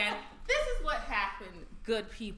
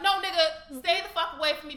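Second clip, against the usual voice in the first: mock yell; 5 semitones higher, 6 dB louder than usual. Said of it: "I'm like".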